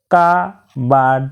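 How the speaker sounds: কাবার্ড drawn out slowly as ka-bard; slow teaching demo of কাবার্ড; syllable by syllable. Shown as a demonstration. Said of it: "দুবারে উচ্চারিত হয়".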